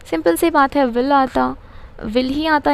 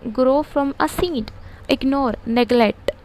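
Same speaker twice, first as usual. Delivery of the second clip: slow dictation; much slower than usual. Shown as a demonstration. Teacher dictating glossary slowly.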